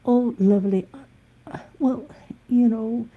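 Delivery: high, sing-song imitation; mock sugary lady. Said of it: "And she's".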